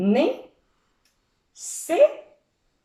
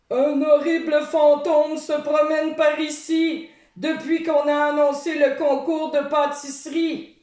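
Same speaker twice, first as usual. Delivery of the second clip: put-on monster-costume boy; 6 semitones higher than usual. A narrator's reading. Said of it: "explique un garçon déguisé en monstre".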